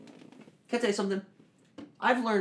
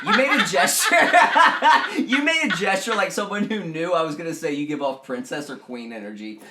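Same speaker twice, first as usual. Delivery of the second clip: high, loud words through laughter; teasing a friend while laughing high and loud; laughing hard; 9 semitones higher, 14 dB louder than usual. Real, not acted. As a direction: crack up while talking.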